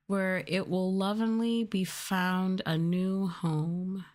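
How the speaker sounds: normal talking voice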